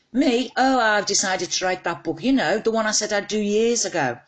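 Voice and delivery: high, eager and a bit caught out, talking to mum; flustered on the phone. Acted. A daughter on the phone to her mum, high and flustered. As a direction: sound bright and flustered, as if caught slacking.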